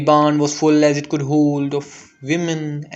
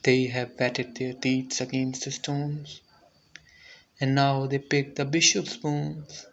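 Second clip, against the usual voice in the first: hushed; 9 dB quieter than usual. A narrator's reading. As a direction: drop to a grim hush. Grim aftermath, hushed.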